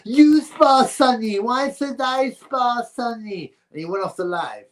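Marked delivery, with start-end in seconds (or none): 0.0-3.5 high, slow, sneering mimic of Michael; mocking whiny imitation. Streamer mimicking a fighter who dodged sparring, high slow sneer. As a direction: parrot him back in a high mocking whine. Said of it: "And he goes".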